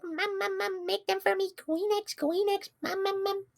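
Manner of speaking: put-on very high whiny baby voice. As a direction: beg like a toddler, squeaky and high. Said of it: "like, a baby voice".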